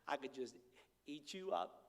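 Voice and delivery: soft, low doting coo; hushed grandfatherly adoration; doting grandpa. Retold or imitated. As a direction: melt over the baby. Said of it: "I'm like".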